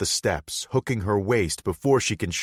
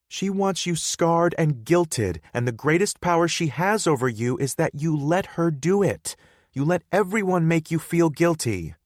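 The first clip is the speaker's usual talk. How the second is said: put-on higher, louder, upset girlfriend voice; hurt girlfriend Hannah; 11 semitones higher, 6 dB louder than usual. Hannah confronting Bodhi, higher and louder. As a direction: confront him for shutting her out.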